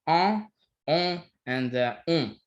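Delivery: en and on said as nasalized French vowels; nasal vowels. Shown as a demonstration. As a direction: push en and on through the nose. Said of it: "but these are pronounced".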